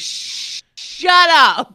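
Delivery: put-on whispered shh, then a loud German-accented shut up. Telling her mocking husband to shut up, German accent. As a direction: hush him, then snap in German accent. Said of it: "I'm like".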